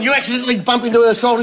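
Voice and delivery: ordinary talk, nothing marked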